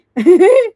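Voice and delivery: loud, high burst of laughter; laughing. Real, not acted. Streamer amused by the baffling accent, loud high laugh. Laughing after mention of Saudi friends.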